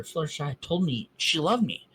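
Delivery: usual speaking voice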